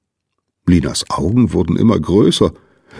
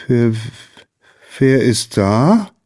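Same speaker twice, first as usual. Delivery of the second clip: slow, higher startled question; stammering; 5 semitones higher, slower than usual. A narrator's reading. Lina startled in bed by a stranger, hesitant stammer. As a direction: stammer it slowly, pitch lifting at the end. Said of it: "stammelte Lina".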